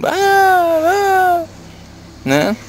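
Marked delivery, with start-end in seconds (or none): 0.1-1.5 high-pitched imitation wail; drawn-out pretend bawl, pitched way up; mock loud crying. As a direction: imitate a child bawling out loud. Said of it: "Você não chorou altão".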